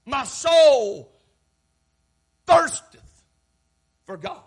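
emphatic, dramatic psalm quotation; fervent cry. Acted. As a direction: punch each phrase out to the congregation. Preacher dramatically quotes the thirsting psalmist.

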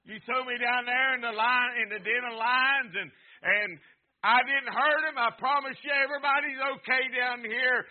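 loud, high calling out from the pit; Daniel calling up from the den. Retold or imitated. Daniel calls up from the lions' den, a loud call upward. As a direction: shout it up to the king, earnest.

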